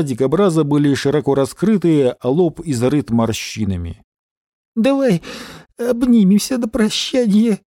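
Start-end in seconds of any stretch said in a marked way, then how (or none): 4.7-7.6 high, tearful farewell plea. A narrator's reading. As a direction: say it tearfully, near sobbing. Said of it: "слезливо произнёс он и всхлипнул".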